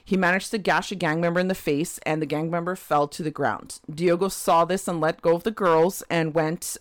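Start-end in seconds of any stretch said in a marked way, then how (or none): none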